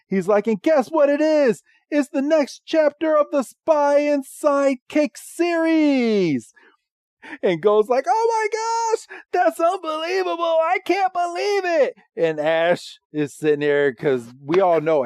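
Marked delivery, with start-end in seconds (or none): 0.5-6.5 play-acted thrilled assistant; excited announcement. Voicing assistant's big movie announcement. 8.1-11.9 very high squealing mock amazement; play-acted high-pitched disbelief; Go thrilled. Voicing Go's amazed reaction to news.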